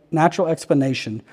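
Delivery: plain reading voice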